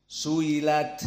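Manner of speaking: "su'ilat" with no hard stop before the i; tashil, hamzah softened. Shown as a demonstration. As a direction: blur the hamzah in "su'ilat" into a glide. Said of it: "Bagaimana ditashil?". ("su'ilat" dibaca tashil, hamzah samar)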